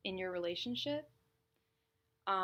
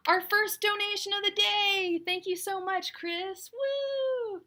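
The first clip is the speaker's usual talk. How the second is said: thrilled, high and loud; excited; 11 semitones higher, 11 dB louder, slower than usual. Real, not acted. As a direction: burst out with joy at the gift. First donation of the day.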